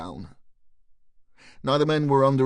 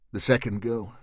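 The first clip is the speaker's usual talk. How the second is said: ordinary delivery, nothing marked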